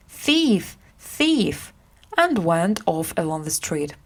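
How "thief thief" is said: higher alarm call of thief; shouting thief. A narrator's reading. Hugo frames Edward in the street, shouted alarm. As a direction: raise a false alarm down the street. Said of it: "He shouted".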